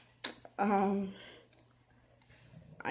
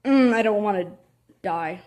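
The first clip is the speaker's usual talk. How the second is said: ordinary talk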